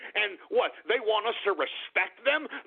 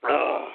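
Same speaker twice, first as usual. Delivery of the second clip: short throaty "ugh"; rough exhale; grunt after a drink. Real, not acted. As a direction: let out a post-swig groan.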